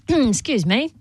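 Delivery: ordinary talk, nothing marked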